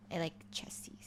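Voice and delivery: whisper. Real, not acted. Answering the icebreaker with an embarrassed whisper. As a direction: whisper it like a guilty secret. Sheepish confession about body parts.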